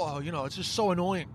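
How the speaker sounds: normal talking voice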